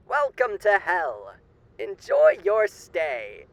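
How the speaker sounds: silky, theatrical old-time radio announcer playing a charming demon; sly radio host. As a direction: purr every line like a grinning showman hiding menace.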